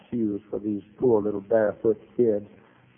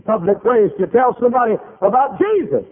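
rising preacher fervour; 10 semitones higher, 6 dB louder than usual. Real, not acted. Preacher building to a peak.

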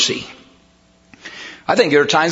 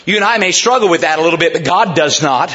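ordinary talk

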